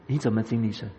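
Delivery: usual speaking voice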